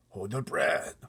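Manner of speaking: ordinary delivery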